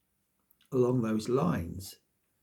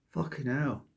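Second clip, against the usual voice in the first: ordinary talk, nothing marked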